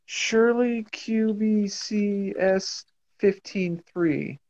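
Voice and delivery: slow, hushed password read-out; whispering a secret password. Retold or imitated. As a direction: murmur the code slowly, secretively. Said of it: "don't whisper the password on the mic".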